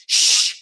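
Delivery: sharp, loud mock shush. Woman shushing her date in a restaurant, sharp. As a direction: shush him, acting it out.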